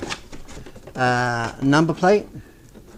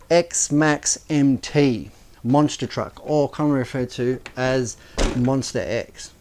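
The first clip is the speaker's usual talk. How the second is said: ordinary delivery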